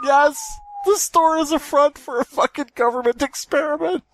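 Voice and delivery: mock excited announcer. Reading the big twist to friends in a very high triumphant voice. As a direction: announce the twist like a game show host.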